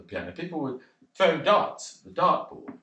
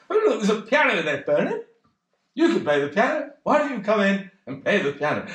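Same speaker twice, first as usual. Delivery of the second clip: lightly voiced eager mate, higher; coaxing friend voice, pitched up; mate Kevin urging; 6 semitones higher than usual. Mate coaxing Bernard toward the pub piano, eager and higher.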